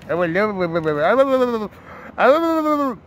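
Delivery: rising, hammed-up wail of alas; mock wail. Tipster groaning in jest about the rain, mock wail.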